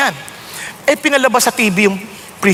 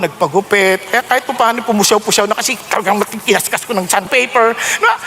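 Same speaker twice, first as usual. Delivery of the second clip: ordinary talk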